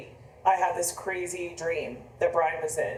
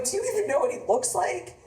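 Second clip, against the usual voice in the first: much higher, rising disbelief; incredulous question; 12 semitones higher than usual. Retold or imitated. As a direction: relive the disbelief.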